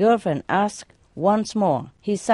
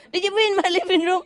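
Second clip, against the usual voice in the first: fast, high scolding question; yelling question; 13 semitones higher, much faster than usual. Retold or imitated. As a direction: demand an answer, quick and high. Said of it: "I keep yelling at him".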